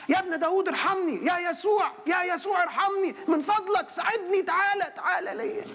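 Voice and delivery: high desperate cry for mercy; a blind man's pleading shout. Acted. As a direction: call out to Jesus at the top of your voice. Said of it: "صرخ".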